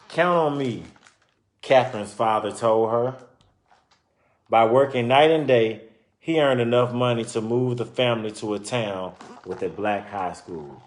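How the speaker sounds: lively storybook narration; reading to children. Acted. Reading a children's book aloud to listeners, lively storytelling. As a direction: read it out like a picture book for kids.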